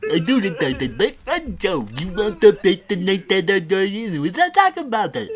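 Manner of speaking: high, loud goofy character voice; silly put-on voice. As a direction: ham up a dopey voice, loud and high.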